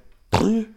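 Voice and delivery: sudden high loud gasp; startled waking gasp; giant gasp. Acted. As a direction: jolt awake with a gasp.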